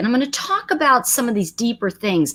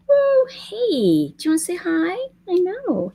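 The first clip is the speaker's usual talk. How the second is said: high cooing voice for the dog; pet talk; 10 semitones higher than usual. Real, not acted. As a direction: coo at the pet, pitched up. Coaxing the dog to greet.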